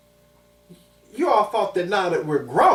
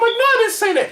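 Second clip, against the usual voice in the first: put-on much higher, louder frightened child's denial; scared denial; 7 dB louder than usual. Boy denying the teacher's accusation, high and scared. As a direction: squeak it out like a terrified kid. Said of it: "I'm like".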